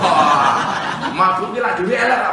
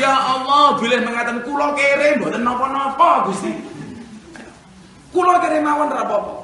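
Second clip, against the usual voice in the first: louder, pleading prayer after the dream; loud tearful prayer; 7 semitones higher, 4 dB louder than usual. Retold or imitated. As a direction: cry out to God, begging to stay poor. Husband prays after waking, crying. (doa keras penuh haru)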